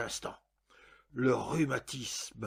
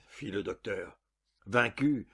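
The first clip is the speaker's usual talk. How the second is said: ordinary delivery, nothing marked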